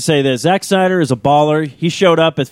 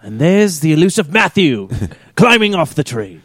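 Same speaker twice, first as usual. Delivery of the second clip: British nature narrator. Pretend. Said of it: "talking British again".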